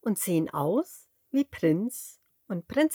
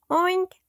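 very high, louder oink; piglet oink call; 11 semitones higher, 4 dB louder than usual. A narrator's reading. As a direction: call a greeting oink to a friend. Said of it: "ruft das Schwein".

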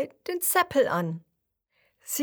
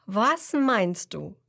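plain reading voice

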